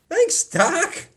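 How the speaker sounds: high, laughing through the thanks; giddy with relief, laugh the words; relieved patient thanking the doctor. Acted.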